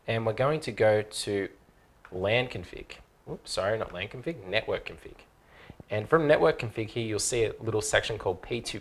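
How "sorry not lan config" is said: ordinary talk, nothing marked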